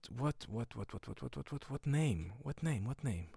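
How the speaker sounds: soft, repetitive muttering to oneself; acted pondering. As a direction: mull it over under your breath. Acts out searching for a name.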